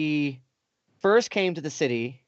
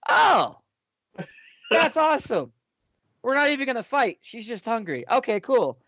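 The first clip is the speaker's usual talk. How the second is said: mock relief; 6 semitones higher than usual. Retelling his own relieved reaction.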